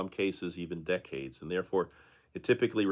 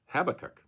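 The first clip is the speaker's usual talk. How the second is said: Habakkuk stressed at the front, HAB-a-kuk; stress on first syllable; 8 semitones higher than usual. Shown as a demonstration. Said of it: "with the emphasis on the first syllable".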